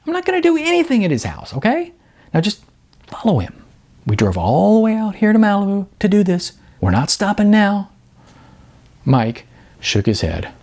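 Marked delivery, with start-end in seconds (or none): 0.1-7.9 high, fast, pleading push; much higher and fast, urging his friend on; exasperated insistence. Acted. Sean insists, frustrated with Mike.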